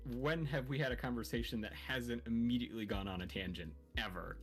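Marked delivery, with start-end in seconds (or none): none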